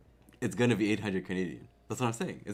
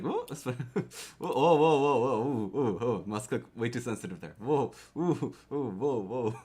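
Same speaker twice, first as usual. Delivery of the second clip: loud, high startled whoa, whoa; excited whoas; 3 semitones higher, 6 dB louder than usual. Retold or imitated. Streamer reenacting a keyboard slip, yelping whoa. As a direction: yelp at a too-sensitive key. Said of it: "I'm just like".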